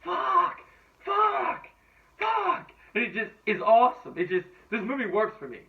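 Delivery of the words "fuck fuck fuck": high-pitched, drawn-out mock scream. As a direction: scream it three times, pitched way up. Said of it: "screaming at the ta-, T V going".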